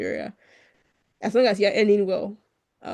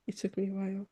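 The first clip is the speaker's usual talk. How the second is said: quiet; 12 dB quieter than usual. Real, not acted. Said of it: "It's still taking me a while".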